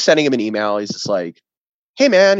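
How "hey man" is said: high, loud, overly friendly greeting; mock nice guy. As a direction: play the nice guy, laying it on thick. Mike's friendly email acted out.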